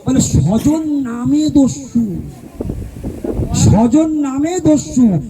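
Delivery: slow, deliberate explanation; slow, emphatic gloss. Shown as a demonstration. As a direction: lay out স্বজন নামে দস্যু slowly for the listeners. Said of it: "explains the phrase slowly".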